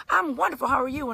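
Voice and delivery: lightly voiced jolly man; jolly mechanic. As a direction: beam it like Santa Claus.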